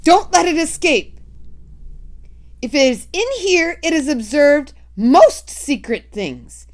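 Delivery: loud commanding witch. Acted. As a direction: hiss out orders as a witch. Grand High Witch orders.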